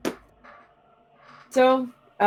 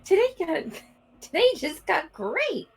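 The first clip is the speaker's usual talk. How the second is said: delighted; 7 semitones higher, slower than usual. Acted. Delighted character.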